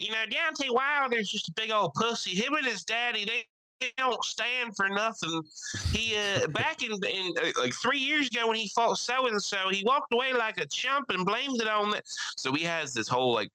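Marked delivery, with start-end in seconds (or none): 0.0-12.0 trash talk the boxer like the country friend; Mike's voice. Retold or imitated. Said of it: "He's like".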